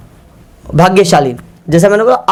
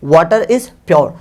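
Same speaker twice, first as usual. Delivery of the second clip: slow dictation; much slower than usual. Shown as a demonstration. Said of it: "तो आप कहेंगे".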